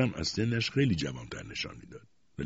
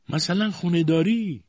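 imperious interrogation; 13 semitones higher, 7 dB louder than usual. A narrator's reading. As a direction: grill her like a haughty aunt. Aunt interrogates mother.

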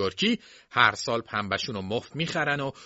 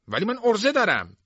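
defiant boast; 12 semitones higher, 5 dB louder than usual. Acted.

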